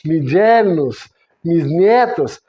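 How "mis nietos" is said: ordinary delivery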